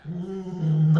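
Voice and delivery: "Mm, no." with a hummed nasal start. Shown as a demonstration. Showing friends the strong nasal no. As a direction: close the mouth, hum "Mm", then release "no". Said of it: "It's very strong nasal".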